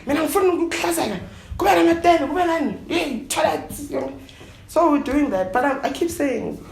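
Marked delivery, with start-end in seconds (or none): 0.1-3.6 high, fast mocking voice of nagging relatives; rattling off demands in a high mocking voice. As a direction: parody the family listing what to buy.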